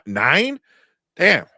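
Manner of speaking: amazed nine, damn; surprised. Real, not acted. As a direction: caught off guard. Surprised at Cameron's count.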